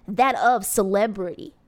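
usual speaking voice

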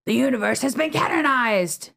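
high, booming cartoon villain. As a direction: announce it like a grand villain. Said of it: "Ooh, good villain voice".

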